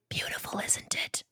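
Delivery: creepy whisper in his ear. Acted. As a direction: whisper it, close and unvoiced. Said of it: "Taylor whispering in his ear".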